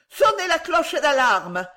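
high-pitched urgent cry; alarm call. A narrator's reading. A dozen voices call for alarm.

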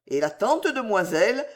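ordinary delivery

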